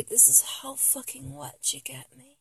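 very soft, breathy whisper; intimate whisper. Acted. Fan confessing to idol, intimate whisper. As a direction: murmur it barely above a breath.

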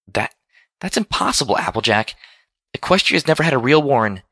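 high, halting protest; falter, then insist it can't be; stammering disbelief. A narrator's reading. Twilight refusing to believe talk of war, stammering disbelief.

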